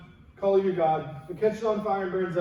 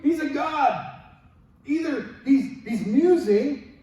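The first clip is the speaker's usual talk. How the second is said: higher, mocking jeer; mocking taunt; 5 semitones higher, 3 dB louder, slower than usual. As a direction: taunt them with sarcasm. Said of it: "Elijah mocked them".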